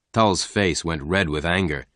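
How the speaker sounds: ordinary delivery, nothing marked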